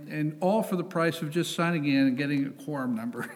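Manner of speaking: ordinary delivery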